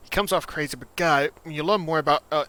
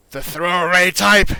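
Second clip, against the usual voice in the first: loud, higher-pitched game character; Echo; 7 semitones higher, 15 dB louder than usual. Acted. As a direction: push it out loud and high, full of bluster. Echo answers the player's question.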